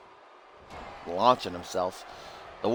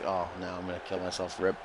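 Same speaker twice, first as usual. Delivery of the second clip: ordinary talk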